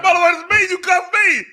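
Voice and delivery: laughing, very high-pitched yell; outraged comic shout. Retold or imitated. Officer to the sergeant who cuffed him by mistake, shouting in laughing outrage. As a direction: shout it in disbelief through laughter.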